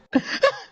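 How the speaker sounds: high maniacal character giggle; Funtime Freddy. Shown as a demonstration. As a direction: burst into a high mechanical-villain laugh. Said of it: "I can do Funtime Freddy".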